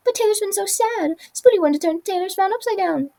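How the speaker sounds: high, eager little voice; squeaky Spoolie. Acted. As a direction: plead kindly in a high squeak.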